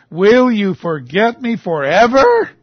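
slow, loud, high plea, rising at the end; anguished question. Acted. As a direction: draw it out loudly as a challenge to God. Verse repeated as a pointed cry.

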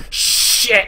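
Logan's strained curse, imitated; strained exclamation. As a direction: push the word out with effort. Acting out Logan struggling to move.

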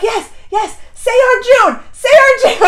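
root for him like the climax of a match; eager cheering. Retold or imitated. Fan urging the king to name Arjun heir, excited cheering.

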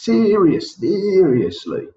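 Serious then seriously, SE pitched high and slow; stress on SE. Shown as a demonstration. Teacher breaking down Serious, seriously, SE high. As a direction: isolate and lift SE in Serious, seriously. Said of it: "Where's the syllable stress?".

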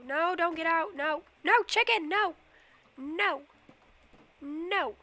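loud, high cries of no at the escaping animals; frantic yelling. Real, not acted. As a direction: yell to stop the chicken getting out.

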